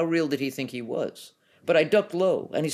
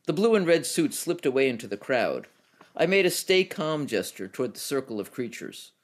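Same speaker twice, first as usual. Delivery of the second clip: plain reading voice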